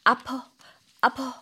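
soft whimpering 아파, light and falling; whiny fake pain. Shown as a demonstration. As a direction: play up a tiny ouch like a sulky child. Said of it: "엄살이죠". (엄살 부리는 목소리)

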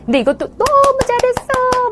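put-on gushing high-pitched praise for a child; squealing mock over-the-top compliment; overdone praise. Shown as a demonstration. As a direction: lay the praise on way too thick. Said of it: "너무 오버해서 칭찬하시는 건".

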